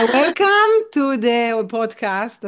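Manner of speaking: put-on slow, English-accented Hebrew host; drawled English accent, playful. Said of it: "במבטא אנגלי כזה".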